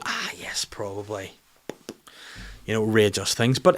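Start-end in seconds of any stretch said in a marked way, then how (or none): 0.1-1.3 breathy whispered giving-in; barely voiced weary agreement; resigned whisper. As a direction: sigh it out as if agreeing you're doomed. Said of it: "and you go".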